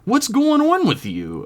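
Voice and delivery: concerned. Acted. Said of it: "with genuine concern in her voice".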